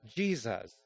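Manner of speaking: "Jesus" with both syllables said clearly; slow, careful "Jesus"; clear, reverent "Jesus". Shown as a demonstration. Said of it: "pronounce both syllables clearly".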